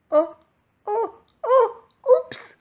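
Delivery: high, loud startled yelps; crying out. A narrator's reading. Santa slipping on ice, loud high cry. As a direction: yelp as you slip on ice. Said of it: "cried Santa".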